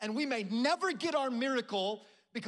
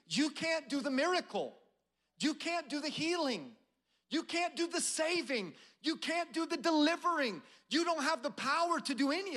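rising litany; 4 semitones higher than usual. Real, not acted.

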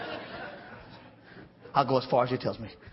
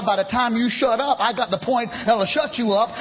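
usual speaking voice

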